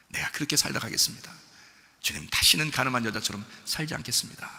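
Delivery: soft, low vow of repentance; hushed, earnest confession to God; quiet prayer. Acted. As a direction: pray humbly, line by line with pauses. Voices the woman's prayer of vow.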